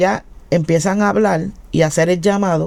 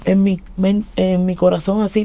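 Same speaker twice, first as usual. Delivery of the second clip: ordinary delivery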